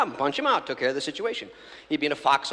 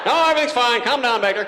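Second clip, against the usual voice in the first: higher, louder, calm-commanding hero; movie hero; 8 semitones higher, 7 dB louder than usual. Retold or imitated. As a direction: take charge of the panic. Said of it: "I used to see the movies".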